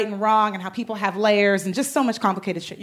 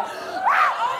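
excited gasp. Retold or imitated. A fan hearing her idol invite her. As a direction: gasp as if the news is unbelievable.

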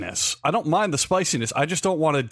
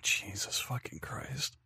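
breathless shocked whisper; 15 dB quieter, much slower than usual. Real, not acted. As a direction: barely voice it, stunned. Stunned by pepper heat rating.

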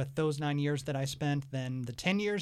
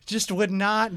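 ordinary talk, nothing marked

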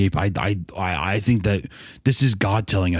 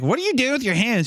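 put-on much higher suspicious little girl; high curious girl. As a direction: ask it sharply like a little sister. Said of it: "D W's like".

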